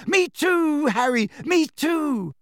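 high, thrilled cry; excited. A narrator's reading. Hermione brandishing her letter to Harry, excited. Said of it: "she said excitedly".

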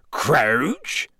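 puzzled rising question; blank, uncomprehending echo; blankly. A narrator's reading. Hagrid echoing the name to Moody, blank. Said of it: "said Hagrid blankly".

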